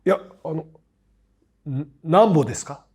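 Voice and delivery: halting attempt at standard Japanese; stumble, then retry in put-on Tokyo speech; forced standard Japanese. Young Osakan repeating his question to a shop clerk, forced standard Japanese. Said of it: "無理して使おうとしてて".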